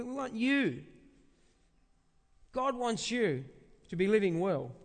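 ordinary delivery

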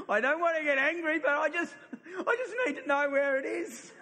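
high, laughing plea to the barman, half-embarrassed; laughing apology. Retold or imitated. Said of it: "I have to say to him".

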